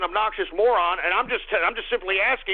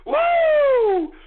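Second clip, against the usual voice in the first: loud high woo of triumph; high celebratory whoop; 5 dB louder than usual. Real, not acted. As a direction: let out a victory whoop.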